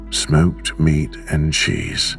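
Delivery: ordinary delivery, nothing marked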